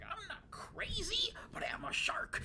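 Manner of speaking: hammed-up talking shark; crazy shark. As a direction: play a manic shark protesting in a squeal. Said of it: "And it's just like".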